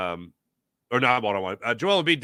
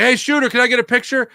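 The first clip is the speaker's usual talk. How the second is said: eager fan; 10 semitones higher, 9 dB louder than usual. Retold or imitated. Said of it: "he's like".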